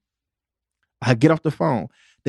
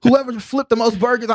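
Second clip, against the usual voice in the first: higher mock boss; 6 semitones higher than usual. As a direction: lift "Whoever" up like a hyped manager. Repeat of boss quote, higher.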